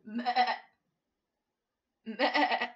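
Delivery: loud bleating meh; loud goat bleat. Acted. Goat sound clue.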